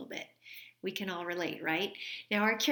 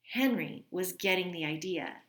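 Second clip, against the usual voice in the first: plain reading voice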